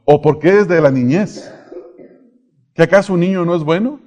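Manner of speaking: earnest preacher's cadence; sermon preaching. Acted. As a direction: preach it to the congregation. Preacher expounding to congregation.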